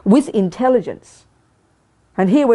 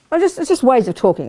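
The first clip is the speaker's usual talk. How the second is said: usual speaking voice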